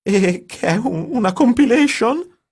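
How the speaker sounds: high, loud mocking disbelief; mock the idea outright; sarcastic high-pitched question; incredulous question. A narrator's reading.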